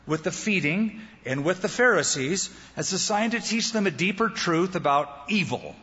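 ordinary delivery

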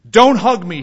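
loud mock growl at being hugged; growled rebuff. Hostile pastor rejecting a colleague's hug, growled. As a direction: growl it out forcefully, imitating the enemy. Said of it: "he growled".

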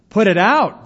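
loud, high, urgent shout; crying out. A narrator's reading. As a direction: cry it out in protest. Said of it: "they cried".

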